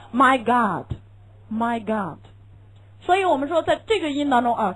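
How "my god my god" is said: "my God" slow, with a wide-open ah; American my God. Shown as a demonstration. Teacher demonstrating the American "my God" slowly. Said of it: "他肯定说my God".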